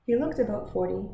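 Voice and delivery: usual speaking voice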